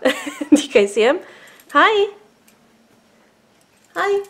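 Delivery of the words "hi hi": high sing-song hello to a bird; high pet voice. Real, not acted.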